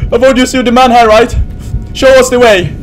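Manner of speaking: loud, high appeal for help; anxious pleading. Real, not acted. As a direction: call on your protector in fright. Scared, pleads for a leader.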